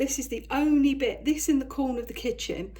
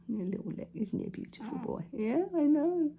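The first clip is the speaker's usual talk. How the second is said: cooing to the cat; 5 semitones higher than usual. Real, not acted.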